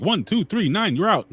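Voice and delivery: mock referee calling a knockout; imitated referee count. Joking about what the referee could have done, high mock count.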